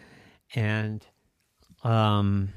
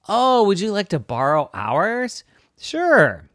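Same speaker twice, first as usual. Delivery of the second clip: cheerful dinosaur voice; 10 semitones higher, 7 dB louder than usual. Acted. Character dialogue.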